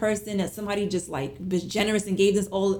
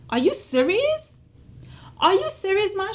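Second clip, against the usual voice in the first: stunned disbelief; 11 semitones higher than usual. Retold or imitated. Retelling her disbelief at mom's news about the check, astonished and high. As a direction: gasp it out like you can't believe the news.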